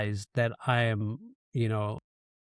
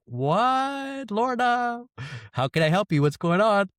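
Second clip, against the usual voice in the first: much higher, startled voice; shocked; 9 semitones higher, slower than usual. Retold or imitated. A surprise call from Lorna, shocked and much higher. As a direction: gasp out her name in disbelief. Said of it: "I was shocked".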